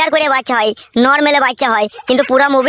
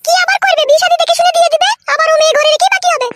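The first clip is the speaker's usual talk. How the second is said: high-pitched scolding woman's voice; a sharp-tongued village wife cutting in; wife's voice. Acted. Village wife cuts in on the men, high-pitched and sharp.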